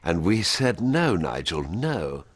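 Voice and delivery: regal, dismissive Thatcher. Acted. Thatcher royal we.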